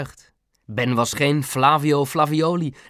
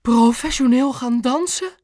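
high-pitched, momentous question; breathless awe; 11 semitones higher, 4 dB louder than usual. A narrator's reading. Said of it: "ze ademde diep in". (hoog en vol verwachting)

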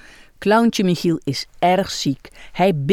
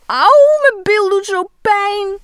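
very high, loud sobbing complaint; hammed-up crying; 12 semitones higher, 5 dB louder than usual. Michiel wails to nurse Iris, sobbing high. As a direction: cry out the pain theatrically.

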